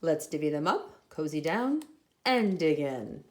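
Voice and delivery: cozy invitation to dig in; badger voice. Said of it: "badger says with a grin".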